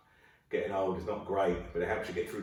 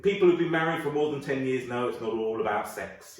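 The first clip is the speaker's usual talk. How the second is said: ordinary talk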